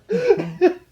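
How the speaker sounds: loud, high giggle; real cackling at your own joke. Real, not acted. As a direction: burst out in high-pitched giggles.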